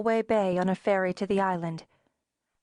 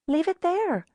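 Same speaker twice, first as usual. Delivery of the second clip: Sharon's voice; 9 semitones higher than usual. A narrator's reading. Sharon casual over phone.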